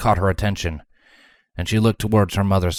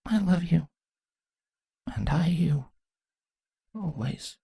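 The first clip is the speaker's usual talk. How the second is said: tender; 9 semitones higher, 8 dB quieter than usual. Acted. Mother and daughter's tender goodbye.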